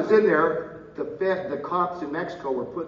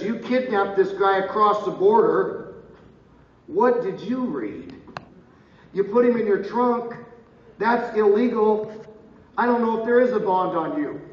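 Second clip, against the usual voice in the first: louder, higher rebuke from the bench; raised-voice courtroom telling-off; stern judge scolding; 5 semitones higher, 4 dB louder than usual. Retold or imitated. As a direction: lay into the defendant as the judge. Retells judge scolding him in court.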